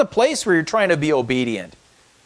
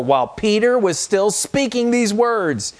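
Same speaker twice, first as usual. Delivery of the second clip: slow, raised reading of the verse; scripture reading, slowed; 8 semitones higher than usual. Acted. Pastor slowly reading scripture aloud to the church. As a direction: lay out each word of the verse.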